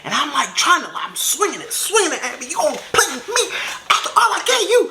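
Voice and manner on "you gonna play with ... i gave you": retold frantic outburst; acted hysterical outrage. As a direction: shriek it like a betrayed girlfriend. Acted knife-swinging outburst.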